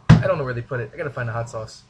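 usual speaking voice

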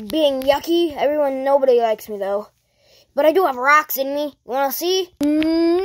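ordinary talk

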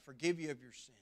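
ordinary delivery

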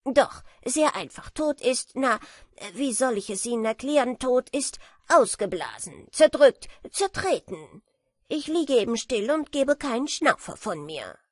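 a smug know-it-all beetle teasing; lightly voiced boastful beetle; proud beetle. A narrator's reading. Beetle describes death.

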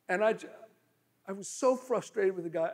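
ordinary talk, nothing marked

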